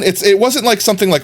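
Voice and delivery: ordinary talk, nothing marked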